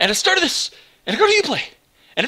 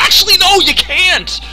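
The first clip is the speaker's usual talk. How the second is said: much higher, louder gotcha reversal; mocking Uplay; 8 semitones higher, 7 dB louder than usual. Gamer mocks Uplay refusing offline mode, high loud taunt. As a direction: yank the offer back with a loud taunt. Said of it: "It's like".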